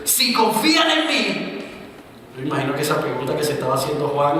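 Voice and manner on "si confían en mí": slow, high, loud proclamation; Jesus crying out. Acted. Preacher voicing Jesus crying out to crowd. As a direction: call it out slowly to the crowd.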